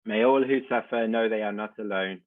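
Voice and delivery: ordinary delivery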